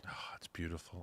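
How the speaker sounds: low, soft mock-reverent sigh; sarcastic awe. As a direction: pretend to be moved, dripping sarcasm. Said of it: "mock awe at quote".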